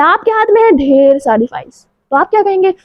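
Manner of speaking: ordinary delivery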